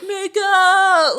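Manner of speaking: high, loud sing-song holler; drawn-out, high-pitched playful shout; mock yell. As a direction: yell it out like heckling the screen. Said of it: "where I yell".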